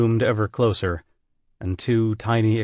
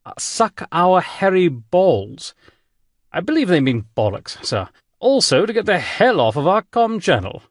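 genteel British admiral, higher and louder; polite English gentleman greeting over the comm; distinguished English accent; 5 semitones higher, 4 dB louder than usual. Acted. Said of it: "with a distinguished English accent".

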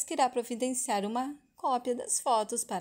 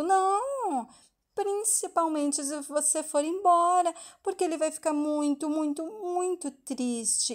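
higher, drawn-out acted child's warning; hammed-up guilt trip, pitched up; guilt-tripping; 6 semitones higher, 5 dB louder than usual. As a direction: lay it on thick, stretching the words. Child guilt-tripping friend about grandfather's sadness.

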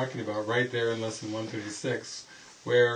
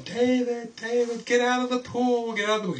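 high shrill-free yelled warning, much higher and louder; retold scream; 12 semitones higher, 5 dB louder than usual. Daughter screaming at David in the pool. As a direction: yell at him to get out of the pool. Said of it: "started screaming at me in the pool".

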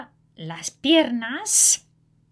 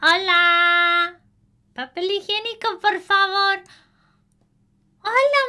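high voices; 11 semitones higher, 8 dB louder than usual. A narrator's reading. Girl and neighbor exchange.

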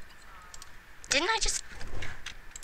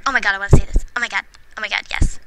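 normal talking voice

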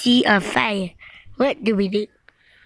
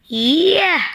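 excited cheer; 6 semitones higher than usual. Acted. Cheer after the spell.

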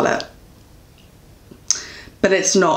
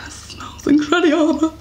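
mock high sing-song; 9 semitones higher than usual. Quoting the blurb, high voice.

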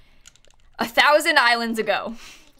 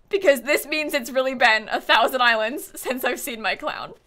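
ordinary talk, nothing marked

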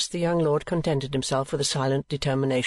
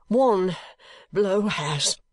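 panting; 5 semitones higher, much slower than usual. A narrator's reading. Young lord answering the onlookers, panting. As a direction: gasp it out, winded. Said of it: "was the panting reply".

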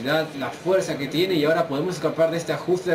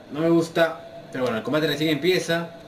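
ordinary delivery

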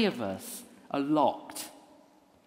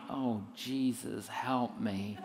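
muttered plea; 6 semitones lower, 7 dB quieter, much slower than usual. Acted. Inner plea in awkward moment.

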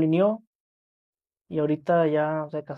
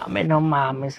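usual speaking voice

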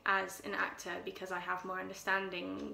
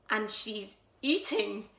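louder, higher, building delight; Louise growing excited; 5 semitones higher, 8 dB louder than usual. Acted. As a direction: let the joy swell as the cat comes closer. Louise grows delighted about the cat.

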